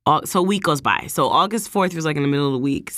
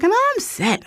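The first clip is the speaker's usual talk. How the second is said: high defensive denial. Retold or imitated. Denying being upset.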